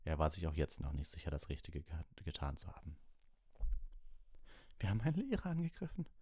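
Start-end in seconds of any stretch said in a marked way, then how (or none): 4.8-6.1 very high, rushed whimper; thin, panicky squeak. A narrator's reading. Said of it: "wimmerte Hermine".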